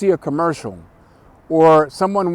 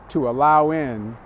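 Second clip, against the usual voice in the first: ordinary delivery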